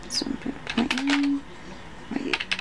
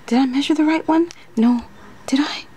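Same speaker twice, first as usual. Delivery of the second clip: higher, louder and fast, in a rush of worry; panicked question; 4 semitones higher, 4 dB louder than usual. Real, not acted. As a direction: fire it off in a sudden flash of worry. Panicked self-check.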